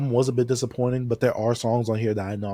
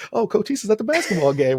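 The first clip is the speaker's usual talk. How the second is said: higher, louder mock fan exclaiming; mocking fans who mistake someone for Cochise, high and excited; clueless fan; 10 semitones higher, 5 dB louder than usual. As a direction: gush like a fan who got it wrong. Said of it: "they'll be like".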